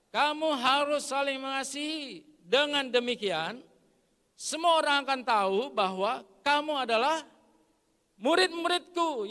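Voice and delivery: high, loud scripture proclamation; loud group reading. Real, not acted. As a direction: lead the congregation through the verse at full voice. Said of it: "baca ini sama-sama dengan suara keras". (membaca bersama dengan suara keras)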